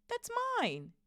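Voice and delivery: very high, indignant cry; high-pitched boy's objection; protesting. A narrator's reading. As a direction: pipe up in urgent protest. Harry protests as letter is snatched.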